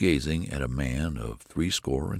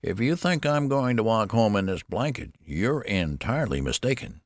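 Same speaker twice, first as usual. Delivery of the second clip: put-on higher, querulous old man; indignant protest; 6 semitones higher than usual. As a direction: object stubbornly with raised pitch.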